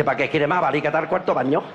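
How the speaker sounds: low-pitched acted doctor's retort; réplica del médico. Doctor's deadpan reply to patient. (respuesta del médico en tono grave)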